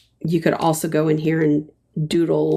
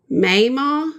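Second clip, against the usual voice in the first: Mayma stretched with a high, rising Southern vowel; Southern drawl; 7 semitones higher, 3 dB louder than usual. Shown as a demonstration. Host copying a viewer's Southern way of saying Mayma, high. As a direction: pitch Mayma up like a Southern granny. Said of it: "that's real Southern".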